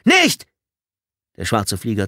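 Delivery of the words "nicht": explosive high shout; roaring. A narrator's reading. Tommy warning the airman off the wire, roaring. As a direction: bellow one urgent warning word. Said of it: "brüllte Tommy".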